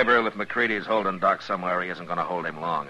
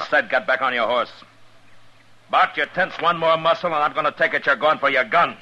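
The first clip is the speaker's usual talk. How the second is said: raised, hard command to back off; loud threat to a gunman; stern warning; 8 semitones higher, 4 dB louder than usual. Acted. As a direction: bark the order louder. Marshal confronting McCready and Bart.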